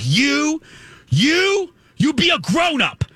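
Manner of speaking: high-pitched scolding yell; imitated screaming. Retelling her phone call to him, imitated screaming. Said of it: "screaming at the top of her lungs".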